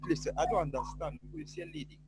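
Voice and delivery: faint, far from the mic; barely audible. Real, not acted. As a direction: voice fading to almost nothing. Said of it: "your voice is very low".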